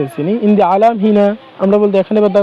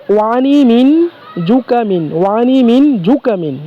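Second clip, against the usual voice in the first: slow careful demo; 3 semitones higher, slower than usual. Shown as a demonstration. Teacher slowly models saying he has a cold in Arabic. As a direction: draw out Wani মিন জুকামিন slowly. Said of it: "তাহলে আমরা বলতে পারি".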